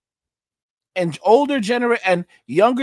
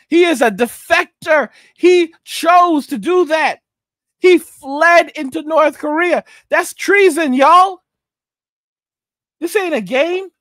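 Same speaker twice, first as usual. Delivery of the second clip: raised, higher, slowed on key words; indignant outburst; 5 semitones higher, 3 dB louder than usual. Real, not acted. Ranting to the chat about the defector, indignant. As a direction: drive home that it's treason.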